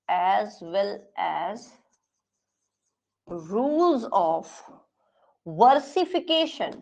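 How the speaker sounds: very slow, word by word, with long pauses; slow dictation. Acted. Teacher dictating notes to the class, slow and spaced. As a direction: dictate each word so students can write.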